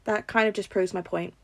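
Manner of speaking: ordinary delivery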